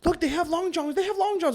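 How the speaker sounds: high-pitched imitation of an excited mom; excited mom impression. Mom pointing out Long Johns to her son, high excited mimicry. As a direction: pitch way up, mimic mom's urging.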